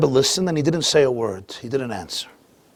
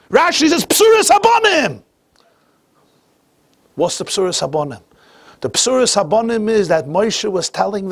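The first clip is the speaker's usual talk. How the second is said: high, loud, ringing sermon delivery; preaching at full force; 14 semitones higher, 5 dB louder than usual. Acted. Preacher lays out the verse to the congregation, full force. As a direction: preach it out to the whole room.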